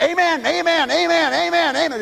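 high, rapid-fire imitation amens; over-eager amens. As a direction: mimic a fanatical congregant firing off amens. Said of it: "people that, before I could get".

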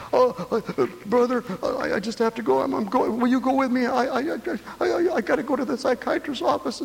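high, flustered stammering woman. Retold or imitated. Preacher imitating a flustered woman begging him to come. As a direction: stammer it out high like a frantic lady. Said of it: "She said".

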